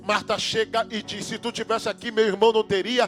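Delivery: loud, high, rising sermon climax; preaching at full force. Acted. Preacher shouting over the church about Mary's tears.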